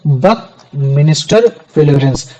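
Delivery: बकमिनिस्टर फ्लोरेंस spelled out slowly, syllable by syllable; slow name demo. Shown as a demonstration. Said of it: "जिसको हम लोग बोलते हैं".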